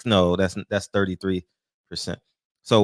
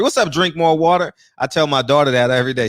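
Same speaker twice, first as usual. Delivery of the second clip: ordinary talk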